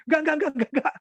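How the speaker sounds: high, rattled-off string of no's; acted hasty refusal. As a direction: hurriedly brush off a pushy offer. Acts out hurried refusal.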